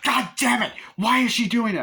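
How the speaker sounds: mother freaking out on the phone, mock hysterical yell. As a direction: throw it out in a frantic fit. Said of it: "Missus McNeil is freaking out".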